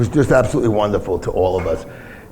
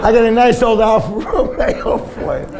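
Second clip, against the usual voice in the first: laughing through it; 11 semitones higher than usual. Retold or imitated. Retold quote, laughing through it.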